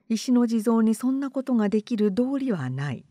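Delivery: plain reading voice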